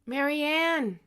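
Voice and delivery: high, loud shout of a name. Acted. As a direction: call out sharply to Marianne. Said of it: "he shouted".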